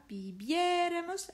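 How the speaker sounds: bibiéramos with a high, stretched-out É; stress on accented é. Shown as a demonstration. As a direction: hold and lift the É in bibiéramos.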